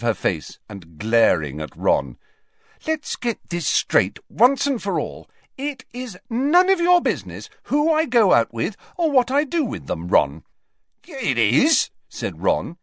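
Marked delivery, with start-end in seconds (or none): 2.9-10.4 high-pitched angry telling-off; angry sister. Acted. Ginny glaring at Ron, setting him straight, angrily. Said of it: "just as angrily". 11.1-11.9 high, angry comeback; angry retort. Acted. Ron answers his sister Ginny back, just as angrily. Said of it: "just as angrily".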